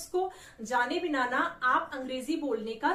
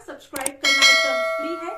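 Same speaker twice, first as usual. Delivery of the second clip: loud push; 12 dB louder than usual. Real, not acted. Channel host urging viewers loudly.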